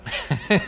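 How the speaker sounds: short relieved laugh. Real, not acted.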